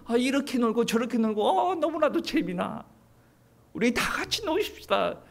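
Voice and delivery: high, laughing, playful invitation to party; mock carefree worldly voice. Preacher mimicking the world, laughing and high. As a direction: play the world luring people to have fun.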